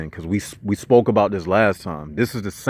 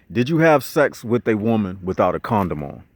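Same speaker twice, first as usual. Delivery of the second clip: usual speaking voice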